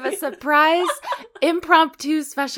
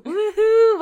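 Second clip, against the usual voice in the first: high excited whoop; cheering woohoo; 10 semitones higher, 4 dB louder than usual. Real, not acted. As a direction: cheer her on. Cheering the guest in.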